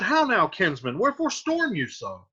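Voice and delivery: rising, questioning uncle; an uncle surprised by the fuss; puzzled Capulet. Acted. Capulet questions angry Tybalt.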